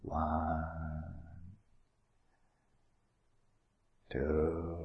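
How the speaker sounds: slow, low, heavy count of one and two; lazy bullfrog counting, slow and low; slow drawn-out counting. Shown as a demonstration. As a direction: drag each number out low. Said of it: "So going".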